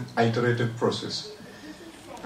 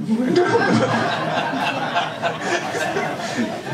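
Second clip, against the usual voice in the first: long delighted laugh; 7 semitones higher than usual. Real, not acted. Recalling the "that's me" moment.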